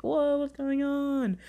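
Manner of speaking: high, drawn-out bewildered reaction; mock surprise. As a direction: act out slow, high-pitched confusion. Acting out her startled reaction.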